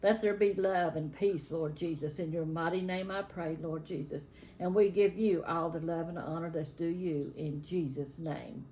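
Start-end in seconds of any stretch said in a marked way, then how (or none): none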